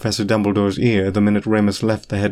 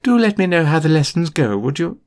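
higher, brisk dismissive teacher voice; brisk McGonagall; 7 semitones higher than usual. A narrator's reading. McGonagall dismissing Remus, brisk higher voice. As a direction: wrap it up crisply.

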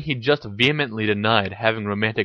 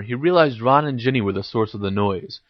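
ordinary delivery, nothing marked

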